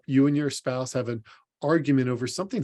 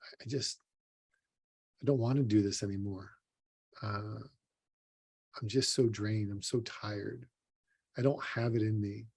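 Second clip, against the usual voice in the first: drained despair; 3 semitones lower, 8 dB quieter than usual. Retold or imitated. A person crying into the pillow at night, voicing drained, quiet despair. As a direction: let it sink to a near-murmur of exhaustion. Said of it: "And you just feel like".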